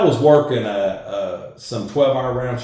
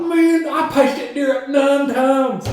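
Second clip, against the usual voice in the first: laughing exasperation; 12 semitones higher, 6 dB louder, slower than usual. Retold or imitated.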